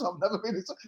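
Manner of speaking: ordinary talk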